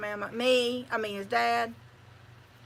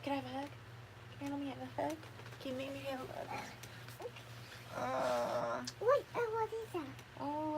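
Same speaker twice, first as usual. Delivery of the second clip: soft, higher coaxing voice for a little one; coaxing sweetly; 3 semitones higher, 7 dB quieter, faster than usual. Real, not acted. Coaxing a little one for a hug, soft and higher. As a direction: gently ask the little one for a hug and kiss. Coaxing someone for hugs, kisses.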